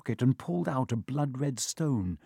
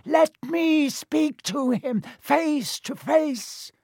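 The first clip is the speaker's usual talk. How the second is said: very high, slow villain voice; 14 semitones higher, 3 dB louder, much slower than usual. A narrator's reading. Hidden master demands to face Harry, high voice. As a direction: draw each word out high and eerie. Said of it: "The high voice spoke again".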